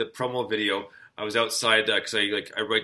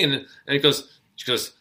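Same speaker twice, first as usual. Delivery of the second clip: ordinary talk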